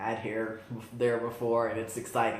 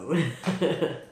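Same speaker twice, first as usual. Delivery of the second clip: short, higher-pitched chuckle; amused laugh; 4 semitones higher, 3 dB louder than usual. Real, not acted. Vlogger jokes about the manufacturing process, amused laugh. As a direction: laugh lightly at your own joke.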